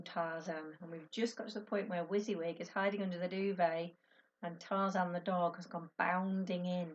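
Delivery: plain reading voice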